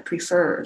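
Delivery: prefer with the stress on fer; pre-FER, second syllable hit. Shown as a demonstration. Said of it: "这个重音是在第二个音节". (prefer重音放在第二个音节fer)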